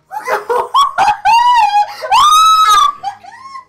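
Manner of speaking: high loud shrieking laughter with tears; hysterical laugh-cry. Real, not acted. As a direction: howl with laughter. Overwhelmed laughing fit.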